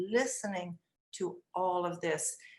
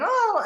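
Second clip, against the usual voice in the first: high, loud mimicked scream; imitated child scream; 10 dB louder than usual. Upset boy starts screaming at teacher, imitated scream. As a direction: cry out like an upset small boy. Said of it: "so he starts screaming then".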